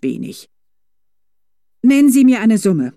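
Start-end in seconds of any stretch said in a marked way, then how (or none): 1.8-2.9 loud, high demand. A narrator's reading. Felicitas demanding a sum from the driver, commanding. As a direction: order him to name a price. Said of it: "forderte sie den Mann auf".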